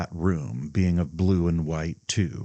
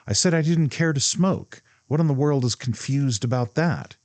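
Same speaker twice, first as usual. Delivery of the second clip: raised, higher indignant protest; 8 semitones higher than usual. A narrator's reading. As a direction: push back hard, offended.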